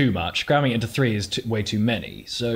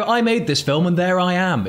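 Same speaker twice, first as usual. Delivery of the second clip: proud director's boast; 8 semitones higher, 4 dB louder than usual. Retold or imitated. Director boasting about his cameo.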